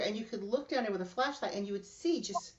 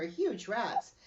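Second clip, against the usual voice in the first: ordinary delivery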